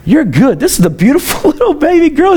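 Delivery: high, swooping delight with a laugh; re-enacted joyful praise. Father re-enacts thanking Jesus for his baby, delighted praise. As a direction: retell rejoicing, pitch leaping up.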